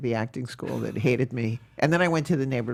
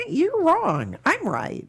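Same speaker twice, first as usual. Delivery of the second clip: defiant retort; 13 semitones higher, slower than usual. Retold or imitated. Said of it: "I was like".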